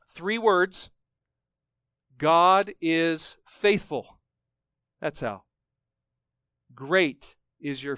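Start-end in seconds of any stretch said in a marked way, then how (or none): 2.2-4.2 God is faithful, each word drawn out slowly; slow emphatic answer. Real, not acted. Preacher answering the congregation's question, slow and emphatic. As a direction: lean hard on God and take it slowly.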